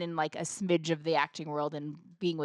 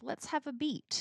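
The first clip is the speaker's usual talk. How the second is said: slow, calm; 6 semitones higher, 9 dB quieter, slower than usual. Retold or imitated. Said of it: "I'll be like".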